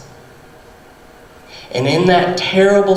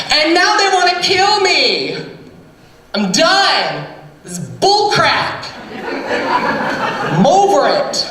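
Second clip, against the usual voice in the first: tantrum; 9 semitones higher than usual. Acted. Elijah ranting at the Holy Spirit in a high tantrum. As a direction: throw a whining tantrum at the Spirit. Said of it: "he threw a temper tantrum".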